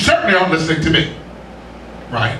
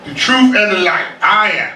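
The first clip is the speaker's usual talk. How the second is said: very slow, each phrase weighed; slow emphatic; slower than usual. Acted. Preacher repeating Jesus to the congregation, very slow. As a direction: drag it out for weight.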